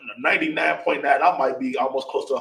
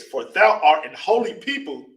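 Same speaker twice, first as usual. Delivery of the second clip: loud, slow proclamation of scripture; scripture reading; 3 semitones higher, 5 dB louder than usual. Acted. Reads scripture aloud on request.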